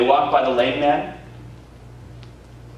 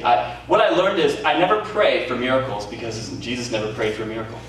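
normal talking voice

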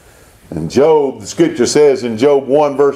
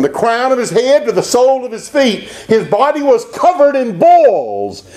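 high, vivid pulpit delivery; emphatic, raised-pitch sermon storytelling; impassioned preaching; 10 semitones higher than usual. Real, not acted. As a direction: preach the affliction with mounting intensity. Preaching at full force.

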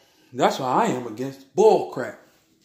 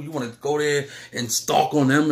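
usual speaking voice

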